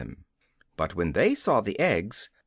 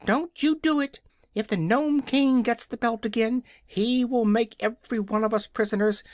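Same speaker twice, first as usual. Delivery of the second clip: hen crying out; 9 semitones higher than usual. A narrator's reading. Billina the hen warning Dorothy, crying out high. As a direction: squawk the warning high and urgent. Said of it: "but Billina cried out".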